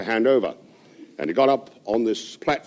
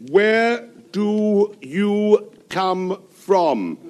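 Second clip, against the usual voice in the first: very slow, high, each word spelled out; exaggerated careful diction to a foreigner; over-enunciated; 8 semitones higher, much slower than usual. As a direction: say it slowly and clearly as to a foreigner. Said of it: "he enunciated very, very clearly".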